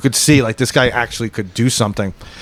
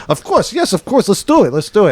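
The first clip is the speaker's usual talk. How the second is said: nervous eager; 6 semitones higher than usual. Retold or imitated. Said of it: "he's, like, really nervous".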